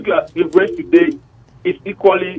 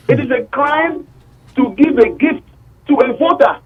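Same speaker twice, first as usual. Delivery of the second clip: outraged; 9 semitones higher than usual. Real, not acted. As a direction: pitch climbing high with outrage. Caller rising in outrage.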